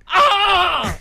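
heart-rending high serve scream; grito desgarrador. Shown as a demonstration. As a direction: scream like a player serving. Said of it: "Es como desgarrador". (grito de saque agudo y desgarrador)

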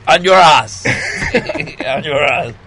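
loud laughing blurt. Pretend. Broken English joke. (grito entre risas)

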